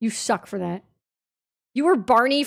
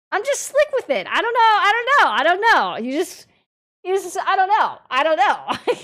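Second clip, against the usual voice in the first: playful high squeak; 7 semitones higher than usual. Acted. Streamer teasing chat, squeaky.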